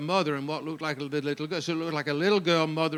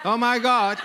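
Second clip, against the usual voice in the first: high, loud mock-Alabama exclamation; hammed-up Alabama accent; 7 semitones higher, 5 dB louder, slower than usual. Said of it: "Can anybody do Alabama?".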